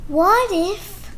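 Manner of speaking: lively picture-book read-aloud with character voices; reading to children. Acted. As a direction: read it like story time for little kids.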